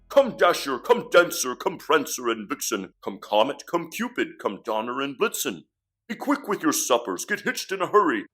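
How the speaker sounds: loud, high Santa rallying his reindeer; calling out. Acted. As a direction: summon the team with brisk authority. Santa calls out his reindeer team.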